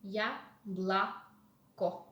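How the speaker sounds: very slow "Яблоко", split ya-bla-ko; drawn-out teaching pronunciation of "Яблоко"; syllable by syllable. Shown as a demonstration. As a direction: sound out "Яблоко" in separate syllables. Teacher sounding out the word.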